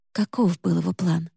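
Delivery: plain reading voice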